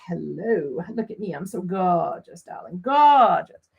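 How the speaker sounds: low, drawled diva voice swelling to the last word; vain diva. Acted. The ink showing itself off on the paper, vain diva. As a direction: play a self-adoring ink, savouring the praise. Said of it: "It's just sitting there like".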